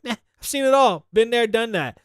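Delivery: lightly imitated been-there indifference; imitated unimpressed kid. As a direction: shrug it off like a jaded child. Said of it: "never seems to be impressed".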